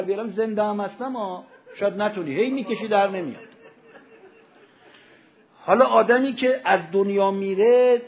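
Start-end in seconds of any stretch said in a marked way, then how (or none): 5.6-8.0 loud, anguished pulpit delivery building to a cry; preacher's mounting outcry; impassioned preaching. Real, not acted. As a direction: drive the horror home at full voice.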